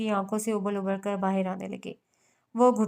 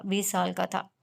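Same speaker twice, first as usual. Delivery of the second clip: plain reading voice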